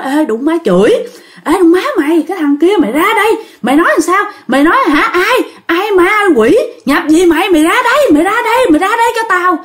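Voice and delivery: high, fast furious yelling; possessed shouting. Retold or imitated. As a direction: yell like someone possessed banging the door. Possessed neighbor yelling at door. (giọng người bị nhập la hét chửi bới)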